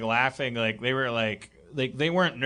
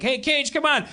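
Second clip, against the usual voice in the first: calling out; 8 semitones higher than usual. Retold or imitated. Bikers calling out to Cage.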